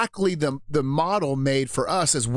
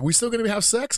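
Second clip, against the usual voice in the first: wife's voice; 8 semitones higher than usual. Retold or imitated. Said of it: "She's like".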